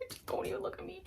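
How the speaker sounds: breathy whisper to the staring people. Retold or imitated. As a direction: whisper it, begging them not to look. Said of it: "and I was just like".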